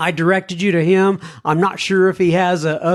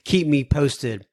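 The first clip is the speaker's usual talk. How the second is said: ordinary delivery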